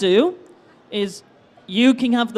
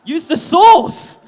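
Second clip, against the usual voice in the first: loud, slow, higher disbelief; incredulous repeat; 6 semitones higher, 6 dB louder, much slower than usual. Real, not acted. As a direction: draw it out loudly, amused and incredulous. Host pounces on misheard Yoda line.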